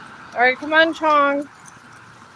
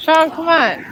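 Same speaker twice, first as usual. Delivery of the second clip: louder, higher call to the dog; 5 semitones higher, 4 dB louder than usual. Real, not acted. Owner calling her dawdling dog Chong, loud and higher. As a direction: call him on up the hill.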